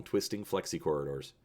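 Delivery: plain reading voice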